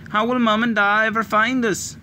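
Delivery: high, tearful plea; sobbing child. A narrator's reading. Little girl sobbing again to her sister. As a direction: cry the question out. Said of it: "Ailish sobbed again".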